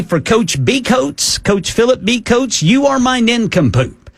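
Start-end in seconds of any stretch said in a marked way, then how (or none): none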